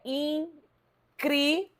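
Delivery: "incrível" devagar, em in-crí-vel, com pausas; separando em sílabas. Shown as a demonstration. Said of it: "a gente separa assim". ("incrível" silabado pausadamente)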